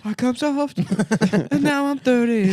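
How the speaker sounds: mocking loser voice. Mocking the gamer, high whine. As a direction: sulk it like a grown man-child. Mocking an adult Pokemon fan.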